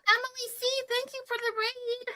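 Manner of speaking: high, bright welcome to the raiders; excited greeting. Real, not acted. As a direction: greet the new arrivals with delight. Greeting incoming raid viewers.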